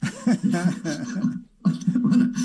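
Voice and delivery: high, slow, laughing through the words; stretched-out laughing protest. Real, not acted. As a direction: giggle as you say it. Laughing through own joke.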